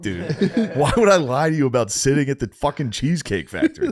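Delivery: high, laughing through the words; cracking up at the absurdity; laughing in disbelief. Retold or imitated. To the staff who wouldn't look up, laughing in disbelief. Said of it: "I was, like, laughing at that point".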